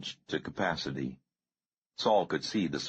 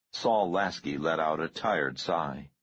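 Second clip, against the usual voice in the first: plain reading voice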